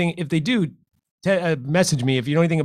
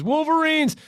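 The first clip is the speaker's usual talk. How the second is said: rallying cry; 13 semitones higher, slower than usual. Retold or imitated. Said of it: "And then Curtis says".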